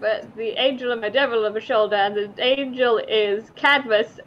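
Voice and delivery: ordinary talk, nothing marked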